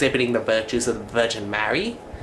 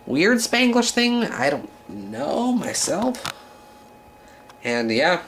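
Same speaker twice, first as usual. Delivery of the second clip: high sheepish giggle; sheepish laughing wrap-up; embarrassed laugh; 9 semitones higher, slower than usual. Real, not acted. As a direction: laugh it off, pitched up.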